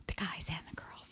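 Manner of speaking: hushed whisper. Real, not acted. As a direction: drop to a whisper. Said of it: "Why are you whispering?".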